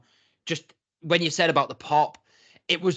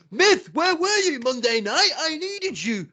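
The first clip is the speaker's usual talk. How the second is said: much higher, slower, put-on whiny voice; mocking R-Truth whine; 11 semitones higher, 3 dB louder, slower than usual. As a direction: play him as a needy, confused wrestler. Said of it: "Rather than him going".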